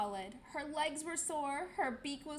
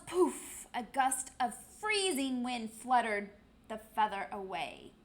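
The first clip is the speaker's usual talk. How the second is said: usual speaking voice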